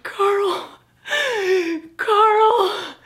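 high, loud wailing cry of his name. Retold or imitated. Pleading with his chiropractor, loud high wailing. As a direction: wail the name like you're falling apart. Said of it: "I'm wailing".

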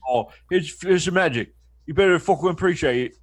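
swagger it like a London hard man showing off a trick; mock London tough guy. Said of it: "talked with Tom Hardy as my diction coach".

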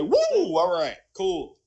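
excited whoop. Retold or imitated. Reliving his own hype to listeners, an excited whoop. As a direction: whoop up high and loud, then cool it down.

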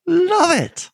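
high, delighted burst; excited. Real, not acted. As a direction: exclaim with delight. Enthusiastic exclamation.

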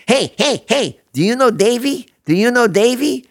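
high, loud, grinning stranger's voice; eager stranger. Retold or imitated. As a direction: pester him like an over-friendly stranger at a gas station. Grinning stranger quizzes the narrator.

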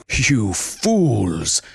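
a hint of a contemptuous villain snap; lightly voiced bark. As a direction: scorn his beaten men, lightly. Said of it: "barked the zookeeper".

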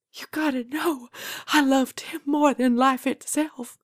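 high, tearful plea easing off in volume; near-whispered grief. A narrator's reading. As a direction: confess love through welling tears. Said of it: "almost whispered these last words".

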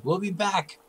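high, put-on goblin threat; goblin bark. Retreating goblin barking back at the party in broken common. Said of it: "barks in broken common".

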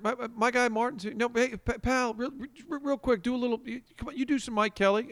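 hyping up his guest on air; Bernie impression. Radio host Bernie eagerly asks Martin for an impression. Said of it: "And Bernie's like".

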